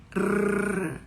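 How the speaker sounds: rolled "rrr" on its own; drawn-out tapping "rrr"; isolated R-like T sound. Shown as a demonstration. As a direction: sustain the "rrr" the T makes. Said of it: "é o T que faz isso".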